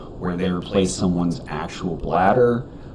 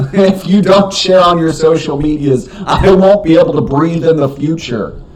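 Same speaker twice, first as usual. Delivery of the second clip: mock desperate plea; 8 semitones higher, 6 dB louder than usual.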